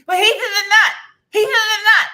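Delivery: high, loud frantic cry; frantic warning. Retold or imitated. Allergic friend warning about the candy, high shout. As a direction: shriek the warning at her. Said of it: "She goes".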